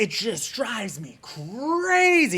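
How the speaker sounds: slow, high, drawn-out "crazy"; exasperated. Real, not acted. YouTuber vents about a pet peeve, exasperated. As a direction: stretch out "crazy" in exaggerated frustration.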